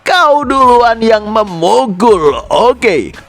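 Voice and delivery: slow, high-pitched annoyance; sangat kesal. A narrator's reading. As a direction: drag out an irritated accusation. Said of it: "sangat kesal dan dia bilang". (kesal, nada tinggi dan ditarik panjang)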